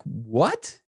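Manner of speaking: high, incredulous questioning; retold disbelief. Driver hearing he's under arrest for a suspended license, incredulous. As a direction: act out being stunned, voice jumping up. Shocked at sudden arrest news.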